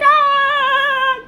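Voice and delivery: sudden high wail of panic, loud; high crying wail. Retold or imitated. Crying at the airport, she wails out loud in panic. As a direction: let out a loud high wail like you just burst into tears. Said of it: "I start crying".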